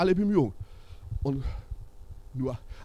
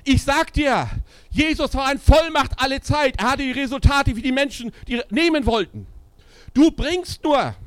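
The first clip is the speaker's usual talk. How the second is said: high, loud preaching at full force; forceful preaching; 13 semitones higher, 10 dB louder than usual. Acted. Preacher to his congregation, preaching at full force. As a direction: thunder it from the pulpit.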